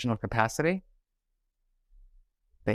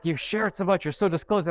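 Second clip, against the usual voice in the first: higher and whiny, a hint of the annoyed little brother; mock complaining brother; 6 semitones higher than usual. Brother scolding her for oversharing about family, mock whiny complaint.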